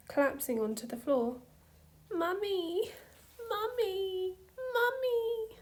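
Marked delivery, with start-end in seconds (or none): 2.1-5.6 put-on rising, ever higher child's cries; a small girl wailing for her mummy, building; desperate toddler. A narrator's reading. Toddler collapsing on floor, crying, higher and higher.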